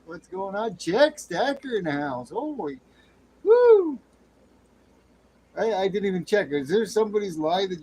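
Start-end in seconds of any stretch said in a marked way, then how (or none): 3.4-4.0 loud, high whoop of delight; excited whoop. Real, not acted. Streamer whooping as a viewer arrives, loud excited whoop. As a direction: whoop to welcome the raider.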